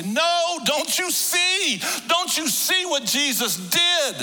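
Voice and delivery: very high, loud, slow cry; anguished scream. Retold or imitated. Preacher wanting to scream at the tourists, loud and high. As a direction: plead at the top of your voice. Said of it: "wanted to scream and say".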